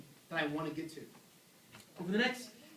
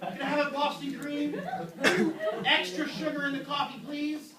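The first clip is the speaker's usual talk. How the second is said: sheepish donut order; 8 semitones higher, 4 dB louder than usual. Pretend. Ordering donuts against intentions.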